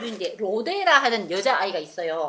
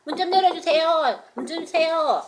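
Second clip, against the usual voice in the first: higher, calling out to be let in; calling through door; 7 semitones higher, 4 dB louder than usual. Acted. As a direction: plead at the door, raised pitch. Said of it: "목소리를". (문 밖에서 높게 부르는 소리)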